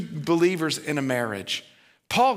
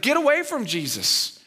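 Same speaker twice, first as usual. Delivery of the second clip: bossy disciples; 10 semitones higher than usual. Retold or imitated. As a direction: shoo the kids off like a self-important gatekeeper.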